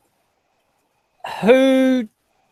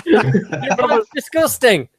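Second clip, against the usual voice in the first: laughing; 6 semitones higher, much slower than usual. Real, not acted. Both picked him, laughing.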